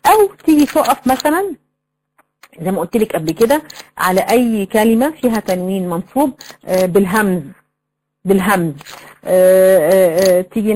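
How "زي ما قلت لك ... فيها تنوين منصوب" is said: usual speaking voice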